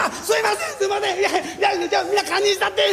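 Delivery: high, laughing apology; smooth it over with a giggling sorry; tipsy master laughing off the blame; master apologizing. Retold or imitated.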